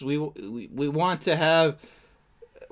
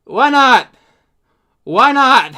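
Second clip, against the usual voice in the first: sarcastic shout; 7 semitones higher, 11 dB louder than usual. Real, not acted. Sarcastic reply to the clip.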